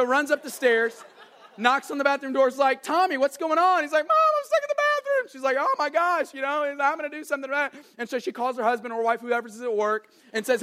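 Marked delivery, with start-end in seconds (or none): 2.8-3.8 high, loud call through a door; acted worried shout to a child; alarmed parent calling. Mom knocking on the bathroom door, calling out in alarm. 4.1-5.3 put-on very high, loud squeal of a stuck kid; panicked toddler wail. Little boy locked in the bathroom to mom, panicked wailing. 5.6-7.8 high-pitched, hurried mock alarm; flustered mom. Mom to her son locked in the bathroom, flustered alarm. Mom reacting to trapped child.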